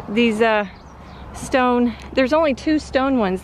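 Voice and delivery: normal talking voice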